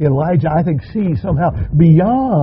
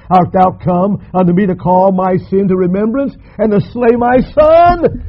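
accusing widow; 6 semitones higher, 6 dB louder than usual. Acted. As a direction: let the accusation build to the last words. Preacher voicing the widow's accusation.

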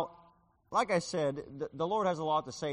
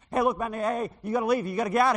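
high, loud frantic warning; urgent warning shout; 8 semitones higher, 10 dB louder, faster than usual. Retold or imitated. Young man yells at the preacher to leave, frantic shout. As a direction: yell at him to get out now.

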